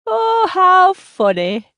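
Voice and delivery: loud, high, drawn-out nasal exclamation; grating, almost nasal. A narrator's reading. Amused seatmate reacting to a stranger's family visit, grating and nasal. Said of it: "She has a voice that grates, almost nasal".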